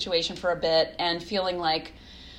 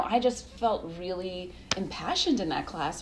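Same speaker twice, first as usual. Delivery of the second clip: usual speaking voice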